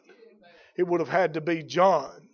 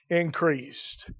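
normal talking voice

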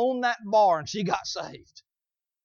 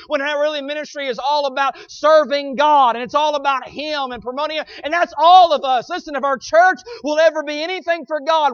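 full-force preaching; 5 semitones higher, 7 dB louder than usual. Real, not acted. Preacher urging the church to make it all about Him, fervent preaching. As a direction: preach it hard, punching the key words.

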